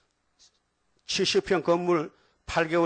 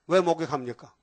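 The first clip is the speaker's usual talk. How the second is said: usual speaking voice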